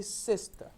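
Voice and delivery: SISTER with the beat on SIS, weak TER; stress on first syllable. Shown as a demonstration. Teacher models for students to repeat, first-syllable stress on sister. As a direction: punch SIS and let the ending drop. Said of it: "the stress falls on the first syllable".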